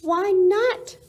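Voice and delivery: high, loud cheering cry. Acted. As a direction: cheer it out loud to coax him down. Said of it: "cried the Ruse with a super loud cheer".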